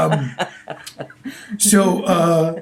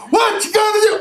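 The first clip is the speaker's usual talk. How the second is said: much higher mock crowd shout. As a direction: yell it back like a heckling crowd. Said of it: "the crowd would go".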